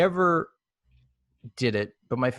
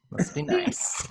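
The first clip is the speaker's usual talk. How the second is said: much higher, breathy falsetto mock of "nice". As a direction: squeak it out in a silly high voice. Says the line twice, second mocking.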